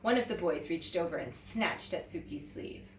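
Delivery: ordinary delivery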